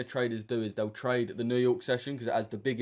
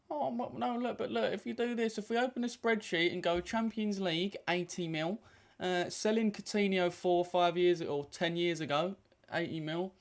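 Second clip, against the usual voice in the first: mocking squeaky voice; 8 semitones higher than usual. Mocking a rival Liverpool fan's spending argument in a whiny voice. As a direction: parody the rival fan high and whiny.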